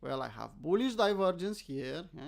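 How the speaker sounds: ordinary delivery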